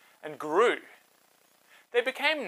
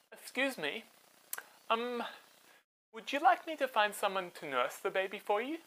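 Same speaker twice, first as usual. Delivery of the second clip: put-on higher, softer, hesitant child's voice; shy little girl; 5 semitones higher, 5 dB quieter than usual. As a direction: timidly ask the princess. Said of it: "little Miriam came up and said".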